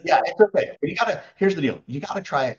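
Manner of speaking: high, quick, encouraging; upbeat reassurance. Real, not acted. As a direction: cheer them on brightly. Reassuring nervous host.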